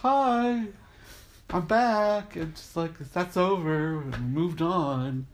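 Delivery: faux-casual cheery greeting; mock innocent breeziness. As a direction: play it cute and innocent. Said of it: "looking like".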